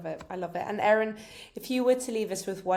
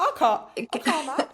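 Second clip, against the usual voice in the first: playful imitation of a Scottish accent, slow and loud; mock Scottish accent; 7 semitones higher, 5 dB louder than usual. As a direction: tease the Scottish accent, slowly. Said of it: "It's my Scottish accent".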